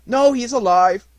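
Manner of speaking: loud, high start easing down; old man firmly correcting; old man reassuring. A narrator's reading. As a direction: burst out with the good news, then settle.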